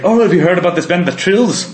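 light imitation of a thick Irish accent. Friend retelling an Irish kid's band tip, mock Irish accent. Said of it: "This really thick Irish accent he had".

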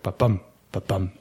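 lightly voiced ba-bum heartbeat; heartbeat sound. Shown as a demonstration. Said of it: "that's how your heartbeat works".

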